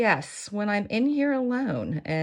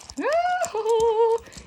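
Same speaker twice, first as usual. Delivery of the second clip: laugh. Real, not acted. Giggling at the turny turn.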